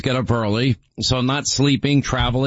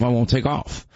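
ordinary delivery

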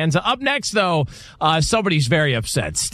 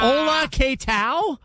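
mock Spanish; 11 semitones higher, much slower than usual. Joking fake Spanish.

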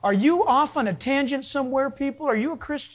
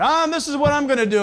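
fast, loud, higher pompous promise; mock politician pledge; 5 semitones higher, 4 dB louder, much faster than usual. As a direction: ham up a lying politician. Mocking lying politicians on the news.

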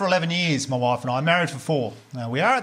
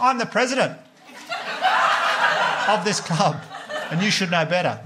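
raised, puffed-up official voice; hammed-up self-important president; pompous club president; 5 semitones higher than usual. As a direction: play the indignant club boss, higher. Acting out pompous club speech.

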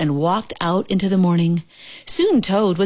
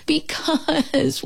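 high, drawn-out whine; wail; 9 semitones higher than usual. A narrator's reading. Toad explains himself to Frog, wailing. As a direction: wail it out, stretching the word. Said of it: "wailed Toad".

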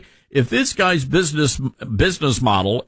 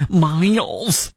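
put-on Michigan accent; 3 semitones higher than usual. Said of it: "that's my Michigan accent".

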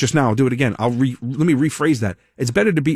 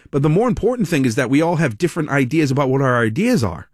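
ordinary delivery

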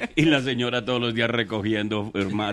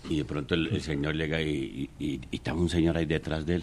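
ordinary talk, nothing marked

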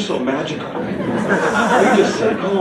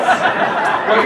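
usual speaking voice

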